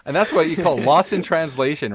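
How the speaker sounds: ordinary talk, nothing marked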